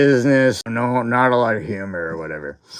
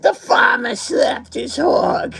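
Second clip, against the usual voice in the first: higher, slow words breaking into laughter; laughing; slower than usual. Retold or imitated. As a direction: force the line out through laughter. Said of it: "he just bursts out laughing".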